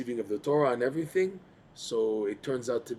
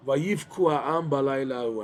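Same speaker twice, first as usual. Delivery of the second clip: normal talking voice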